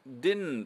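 "didn't" with the second D gone, a vowel then N; playful guess at a future "didn't". Shown as a demonstration. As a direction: say "didn't" like "dinnt". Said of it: "何十年か後に英語ではdidn'tって言うてる".

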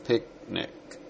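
ペクネック whispered with the k held but never released; ペクネック breathed, k stopped silently; unreleased k, whispered. Shown as a demonstration. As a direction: whisper ペクネック, closing on the k without letting it out. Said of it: "後ろのKは出たけどね。".

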